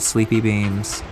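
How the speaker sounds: normal talking voice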